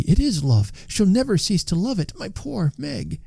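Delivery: put-on high, soft, pleading old father; Trotty pleading. A narrator's reading. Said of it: "said Trotty".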